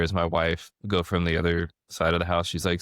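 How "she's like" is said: ordinary delivery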